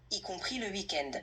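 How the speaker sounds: normal talking voice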